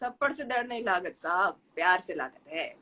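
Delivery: Bhojpuri-flavored Hindi, quick; Bhojpuri accent. Acted. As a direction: say it like a Bhojpuri speaker. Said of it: "Bhojpuri".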